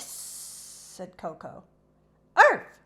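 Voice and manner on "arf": high loud yap; sharp puppy bark. A narrator's reading. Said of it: "barked Peppermint".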